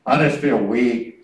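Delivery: mock the defeated believer, slow and low; mock weakling. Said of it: "Don't get up and say".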